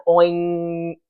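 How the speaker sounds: oink with a nasal ng at the end; oinking sound. Shown as a demonstration. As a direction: say oink and let the ng ring in the nose. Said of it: "that's why we called it a little oinking sound".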